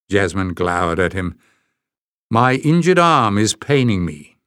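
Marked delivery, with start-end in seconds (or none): none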